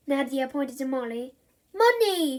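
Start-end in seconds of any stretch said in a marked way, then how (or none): 1.7-2.4 put-on high, loud toddler babble; little girl's word. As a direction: say it like a proud baby. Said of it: "she said".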